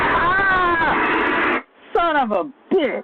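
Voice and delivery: sudden high yelp, then cursing; jolted awake by a fright, yelling; startled shout. Real, not acted. Streamer jolted by a sudden fright, high startled yell.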